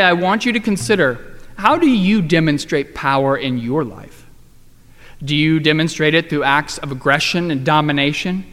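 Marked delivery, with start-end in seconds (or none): none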